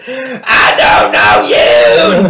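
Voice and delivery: slow, very loud mock scream; frustrated Bobby outburst. Bobby finally snapping at his dad, hammed-up frustrated scream. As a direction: let the frustration burst into a drawn-out yell. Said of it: "He's getting more and more frustrated".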